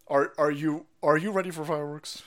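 ordinary delivery

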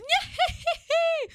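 very high, squealing giggle. Retold or imitated. Showing listeners how friend normally laughs, high imitated giggle. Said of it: "Callum's laugh is".

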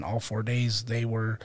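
normal talking voice

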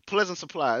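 usual speaking voice

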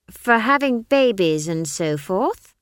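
put-on slow, high child voice, rising at the end; pointed echo. A narrator's reading. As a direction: throw the phrase back as a sharp question. Esk throws his phrase back.

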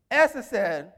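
normal talking voice

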